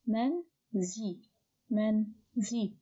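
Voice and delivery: "Men, zi" as two detached syllables, paused between. Shown as a demonstration. Teacher showing the first two syllables, "men, zi" kept apart. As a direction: sound out "men, zi" one syllable at a time. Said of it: "The second syllable is only zi".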